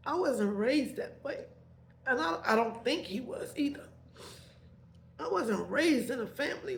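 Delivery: high, crying voice breaking through a laugh; trying not to cry while she tells it; tearful voice. Real, not acted. Tearful while recalling her upbringing.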